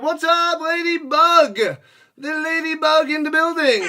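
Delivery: loud, high-pitched welcome; hyped shout-out. Real, not acted. Streamer welcoming Ladybug into the chat, excited. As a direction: holler it like a hype man. Hyped greeting to arriving viewer.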